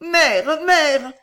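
loud, high-pitched cry; distraught stammer. A narrator's reading. Girl kneeling by the dying woman, crying out in distress. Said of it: "balbutiait Violetta".

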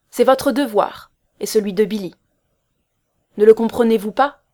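loud, insistent lecture; stern. A narrator's reading. Man telling Loretta her duty, stern. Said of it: "répondit-il sévèrement".

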